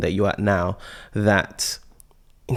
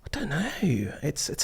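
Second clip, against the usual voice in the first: high breathy whisper, stumped; whispered uncertainty; 5 semitones higher, 8 dB quieter than usual. Real, not acted. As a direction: whisper it, at a loss.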